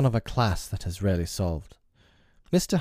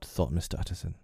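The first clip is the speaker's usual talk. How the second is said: plain reading voice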